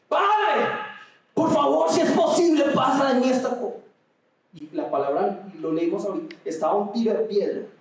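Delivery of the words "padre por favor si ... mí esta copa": very high, loud "Padre, por favor" in anguish; anguished shout. Shown as a demonstration. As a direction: cry out to the Father in agony. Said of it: "No, eso no está estresado". (grito angustiado, muy agudo y fuerte)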